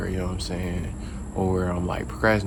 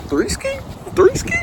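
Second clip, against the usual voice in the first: high hype call. Acted. Hyping up day three.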